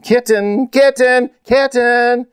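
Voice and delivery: very high, repeated searching calls; hollering. A narrator's reading. Girls search bushes for lost kitten, hollering. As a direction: call out for a lost pet. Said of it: "they hollered as they frantically searched".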